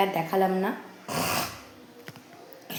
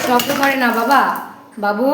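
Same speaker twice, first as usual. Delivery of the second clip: scolding shout; 2 semitones higher, 7 dB louder than usual. Real, not acted. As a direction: tell the little one off loudly. Mother scolding noisy baby.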